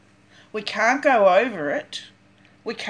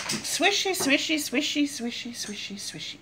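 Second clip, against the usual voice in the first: fading swishy sounds; 3 semitones higher, 6 dB quieter than usual. Acted. Sound effect words, fading.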